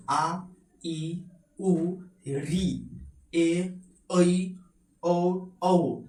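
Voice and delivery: each vowel आ ई उ री ए ऐ ओ औ sounded out one by one with pauses; clear vowel demo. Shown as a demonstration. Teacher shows students how to sound आ, ई, उ, ओ, औ distinctly. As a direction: pronounce आ, ई, उ, री, ए, ऐ, ओ, औ as separate clean vowel sounds. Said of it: "इस तरीका से sound निकालना है".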